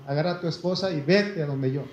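normal talking voice

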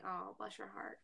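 hushed, condescending pity; mock sympathy. Southerner pitying someone who says you all, softly condescending. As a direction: drop right down into soft mock pity.

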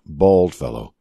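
plain reading voice

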